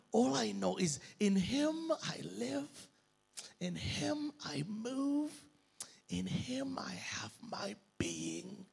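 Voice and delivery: fading from soft to whispered; softening to a whisper. Acted. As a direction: let it sink into a whisper. Believer's calm faith reply.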